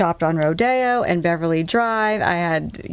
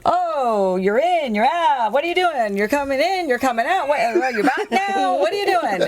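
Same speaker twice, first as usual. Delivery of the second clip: put-on high, singsong New York accent, half laughing by the end; Dominic's New York accent; 7 semitones higher than usual. Dominic greeting the returning shopper, playful New York accent. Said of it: "In his New York accent, says to me".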